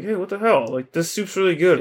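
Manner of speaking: high-pitched mock protest; indignant protest. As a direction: object in lightly acted disbelief. Luffy objects to cooks' insults.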